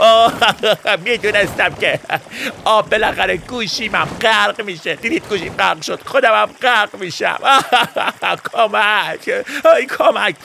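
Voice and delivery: hammed-up drowning cry, high; mock panicked wail. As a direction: fake panic wail mixed with laughs. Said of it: "گریه نکنی".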